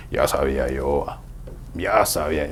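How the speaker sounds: low, soft smug voice; puffed-up self-satisfaction; smug reply. Retold or imitated. Proud man swelling at praise.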